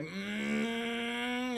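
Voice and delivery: rising jet-engine whine hum; mimicked plane fly-by, drawn-out "mmm"; imitated jet engine. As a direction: hum a long, climbing drone like an approaching jet. Imitates the jet screaming past.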